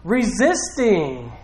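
high, drawn-out preacher's cry; impassioned imitation of a divine yell; yelling plea. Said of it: "is yelling to us".

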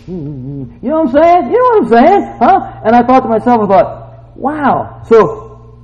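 high, loud preaching shout; full-force preaching. Real, not acted. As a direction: preach it at the top of your voice.

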